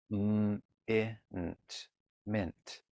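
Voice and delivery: segmenting "mint" into M, I, nt, then a soft blend; sounding out mint. Shown as a demonstration. Teacher modeling how to sound out "mint" for a child. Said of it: "So let's read a few words".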